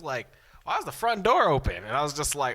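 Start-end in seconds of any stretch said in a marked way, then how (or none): 0.6-1.7 high, quick imitation of mom; questioning mother. Acts out mother questioning him.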